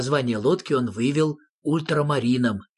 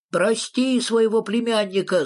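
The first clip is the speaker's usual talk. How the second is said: tag; 8 semitones higher than usual. A narrator's reading. Character pleads for the nephew.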